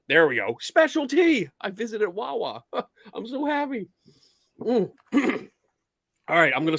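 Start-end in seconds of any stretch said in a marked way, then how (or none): 0.6-1.5 excited. Real, not acted. Repeats it, showing the mug.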